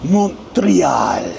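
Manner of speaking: "Montreal" punched as "mon-tree-AHL"; hammed-up French "Montreal", last syllable drawn out; emphatic French-style pronunciation. Shown as a demonstration. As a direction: say "Montreal" with big emphasis on the accented E and long AL. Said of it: "you gotta say it with that emphasis".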